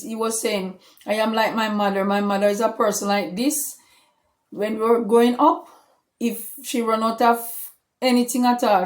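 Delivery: usual speaking voice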